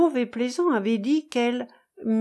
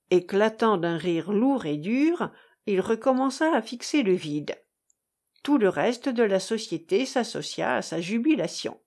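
usual speaking voice

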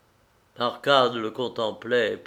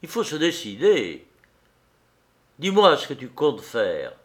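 mildly raised, firm urging; determined, pressing appeal; resolute; 3 semitones higher, 4 dB louder than usual. A narrator's reading. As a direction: press a friend for a decision. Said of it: "dit-il d'un air résolu".

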